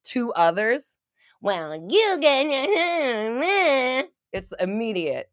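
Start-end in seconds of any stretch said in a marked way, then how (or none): 1.4-4.1 high, slow, drawn-out mock jeer; mocking backlash. Online critic jumping on a creator, in a mocking jeer. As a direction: smear the words into exaggerated heckling noise.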